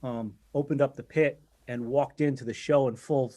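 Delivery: ordinary talk, nothing marked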